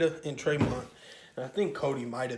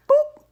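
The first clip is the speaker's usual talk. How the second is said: sudden very high, loud boop; a squeaky comic sound effect; imitated swing sound; 11 dB louder than usual.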